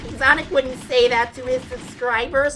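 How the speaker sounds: high-pitched mocking imitation; mock whiny kid. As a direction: parrot a pestering kid's complaint. Mimics the pestering kid.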